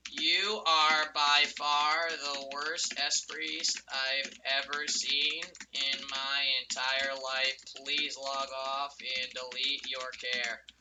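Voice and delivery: slow, low, deliberate reading; dictating insult. Real, not acted. Gamer dictating a chat insult to an enemy, slow and low. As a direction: spell out the insult as you type.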